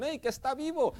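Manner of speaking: high mock shout that he is alive; calling out. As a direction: call out urgently to the king. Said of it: "le dicen".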